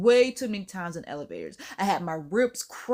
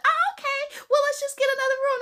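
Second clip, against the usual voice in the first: much higher, airy mock voice of a breezy sister; mocking her sister; 4 dB louder, faster than usual. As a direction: squeak it out like a carefree pushover. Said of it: "she'll be like".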